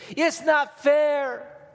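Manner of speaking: put-on very high, loud, very slow kid wail; drawn-out high child's protest; whiny kid. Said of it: "that's all I ever hear from my kids".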